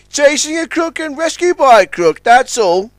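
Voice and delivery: high wry voice; grinning launch. A narrator's reading. Customs launch joking with Big Mac, grinning. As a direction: say it with a grin. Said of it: "with a grin".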